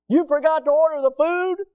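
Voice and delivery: high, loud incredulous question; mock disbelief. Confronting the officer who forgot food, mock incredulous. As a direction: throw it back in acted disbelief.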